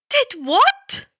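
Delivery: high, rising startled question; startled cry. Acted. The hero hearing about the lawyer, high startled cry. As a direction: blurt it in shock. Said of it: "The hero's voice cried out".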